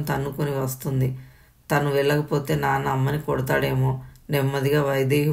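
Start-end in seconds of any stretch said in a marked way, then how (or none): none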